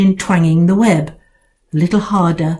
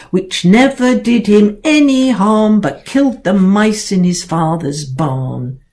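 plain reading voice

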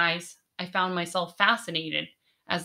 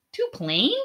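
"Too plain?" with a higher, rising questioning lift; puzzled question; 4 semitones higher than usual. A narrator's reading. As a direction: repeat it back in disbelief. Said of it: "Dihan asked".

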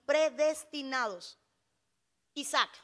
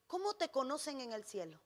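soft, lower-pitched pondering question; hushed question; 3 semitones lower, 7 dB quieter than usual. Real, not acted. Preacher asking the church about heaven, hushed question. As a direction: drop the voice and let the question hang. Question posed softly, then repeated louder.

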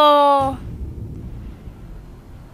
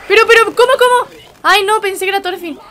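high-pitched, louder disbelief; genuinely taken aback, pitch jumping up; real shock; 6 semitones higher, 4 dB louder, faster than usual. Real, not acted. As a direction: blurt it out high in startled dismay. Shocked reaction to the episode.

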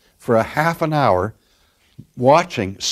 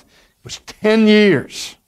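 much higher and louder, slow and drawn out; emphatic, slow; 10 semitones higher, 5 dB louder, slower than usual. Real, not acted. Preacher stressing to the congregation how long she suffered, slow and much higher and louder. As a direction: lean hard on every word to drive the point home.

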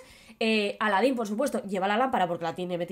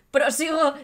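laughing through the word; 3 semitones higher than usual. Real, not acted. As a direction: carry on while laughing. (riendo mientras sigue)

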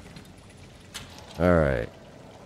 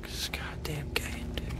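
whispered under the breath; fed-up whisper at the game; frustrated whisper; 11 semitones higher, 14 dB quieter than usual. Real, not acted. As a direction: hiss it in exasperation. Frustrated aside while lost.

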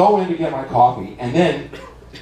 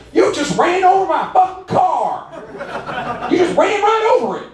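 mock furious high-pitched screech; irate woman yelling. Woman confronts the driver in the parking lot, high hammed-up yell. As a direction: screech it like an outraged car owner.

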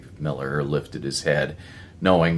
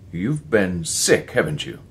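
demanding; 5 semitones higher, 5 dB louder than usual. A narrator's reading. Erickson presses the young druggist about being sick, demanding. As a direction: push him for the truth, each word weighed. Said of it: "he demanded".

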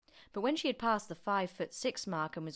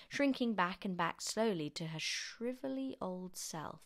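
ordinary delivery, nothing marked

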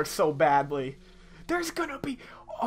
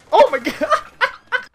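much higher, louder, drawn-out cry with a laugh; real shocked shout; 12 dB louder, slower than usual. Real, not acted. Sudden scare in the game.

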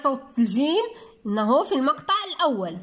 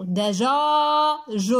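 دجاج as da, long drawn-out jaa, then jun; slow دجاج, last syllable set apart; sounded out slowly; much slower than usual. Shown as a demonstration. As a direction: stretch the middle syllable of دجاج. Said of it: "الصوت ديالو".

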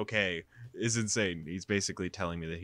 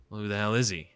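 jealous demand; 6 dB louder, faster than usual. Acted. Jealous Jisoo confronts her.

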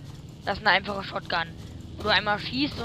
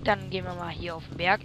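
ordinary talk

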